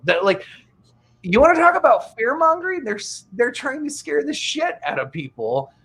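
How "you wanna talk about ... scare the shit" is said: exasperated. Real, not acted.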